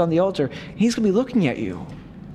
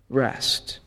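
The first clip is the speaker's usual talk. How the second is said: whispered rest; 4 semitones lower, 5 dB quieter than usual. Acted. Priest urging retreatants to rest.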